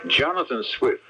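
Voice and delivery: normal talking voice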